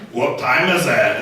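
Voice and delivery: creepy, slow Scottish accent. Shown as a demonstration. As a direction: ask it slowly in a mock Scottish accent. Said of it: "It just sounds creepy when it's Scottish".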